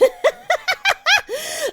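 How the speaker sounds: high, loud drawn-out cackle; flame skull cackle. Acted. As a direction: cackle on and on in triumph.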